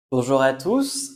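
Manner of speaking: ordinary delivery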